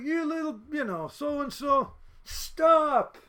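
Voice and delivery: high, hammed-up yell, loud on the stop; mock-yell like a cop ordering someone to halt; imitated yelling cop. Cop confronting student in the parking lot, mock yelling. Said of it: "he's yelling at me".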